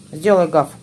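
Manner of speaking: loud, firm order to a dog; firm dog commands. Real, not acted.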